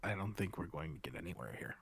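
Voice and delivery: low, very soft, hurried aside; whisper. Acted. Player's character confides in a companion's ear, whispering. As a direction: lean into an ear and keep it hushed. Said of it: "just kinda whisper".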